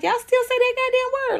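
put-on high-pitched disbelief at the old-fashioned word; incredulous retort. Scoffing at a coworker's old-fashioned word, high and incredulous. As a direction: shoot it back, stunned and scornful. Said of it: "I'm like".

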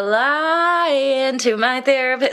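high, slow slide into the note; sliding into the note. Retold or imitated. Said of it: "she really slides into that note".

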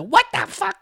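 high mock "what the fuck"; imitating a video. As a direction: toss it up high like the guy in the clip.

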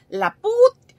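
high loud cut-off insult; mock outburst. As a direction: blurt the insult then stop.

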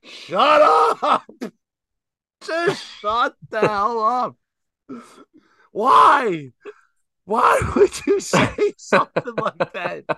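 high, loud exaggerated disbelief; mock outrage. Son retelling his reaction to mom, mock shouting. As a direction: shout it like you can't believe her. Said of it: "I was like".

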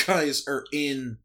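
ordinary talk, nothing marked

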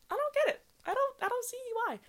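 squeaky disbelief; exasperated. Real, not acted. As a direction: throw up your hands, high. Exasperated rant.

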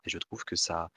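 ordinary talk

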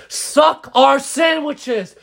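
put-on high, slow drunken yelling; slow mock drunk yell; drunk screaming. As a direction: bellow it at the car window. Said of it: "screaming in her face".

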